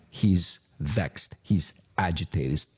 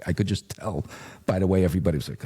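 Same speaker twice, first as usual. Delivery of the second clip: ordinary delivery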